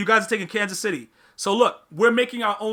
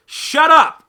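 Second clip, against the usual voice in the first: shout; 8 semitones higher, 11 dB louder than usual. Real, not acted. Shouting down a chat pick.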